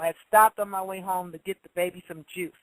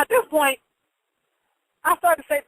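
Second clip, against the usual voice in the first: ordinary delivery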